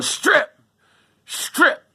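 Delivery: high shrill mock yell of the attacker; retold yelling. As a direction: bark the order like the intruder did. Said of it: "he was yelling".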